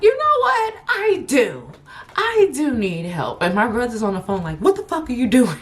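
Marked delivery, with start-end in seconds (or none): 0.0-3.4 much higher, giddy give-up; high-pitched relieved surrender; exasperated yes. Retold or imitated. Accepting ride, exasperated and laughing. 4.6-5.6 higher, fast mock yell; fast high mock outrage; imitating angry brother. Brother yelling on phone.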